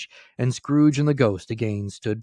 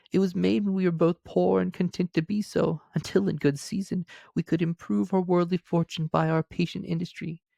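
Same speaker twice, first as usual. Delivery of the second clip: much higher woman's voice; the girl releases young Scrooge, higher voice; grieving young woman; 9 semitones higher than usual. A narrator's reading. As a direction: voice the girl sorrowfully, pitched high. Girl's voice.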